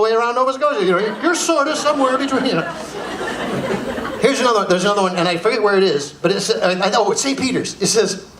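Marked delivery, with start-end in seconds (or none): none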